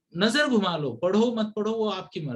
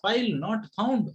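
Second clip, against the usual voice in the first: slow computer error voice; 3 semitones higher, much slower than usual. Pretend. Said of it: "exam में आ गया".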